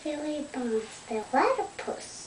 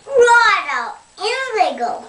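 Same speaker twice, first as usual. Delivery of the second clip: eager; 9 semitones higher, 9 dB louder than usual. Pretend. Dingo cheerfully urges, loud.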